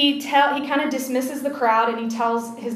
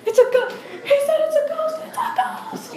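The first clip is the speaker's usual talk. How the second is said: high, squeaky mock panic; hammed-up shrieks passed along the group; pack panic. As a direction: echo the alarm like a comic frightened crowd. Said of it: "pack mentality".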